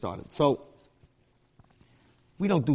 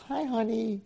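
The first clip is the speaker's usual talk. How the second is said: high singsong mock endearment; lovey-dovey parent; 10 semitones higher, slower than usual. The kid who has a doting home, mimicked lovey-dovey. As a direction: coo it high, imitating a doting wife. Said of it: "she's all lovey-dovey with her husband".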